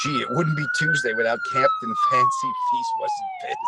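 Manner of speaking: mock him in a squeaky put-on voice; sarcastic high mockery. Mocking Phil's weekly complaint in a squeaky voice.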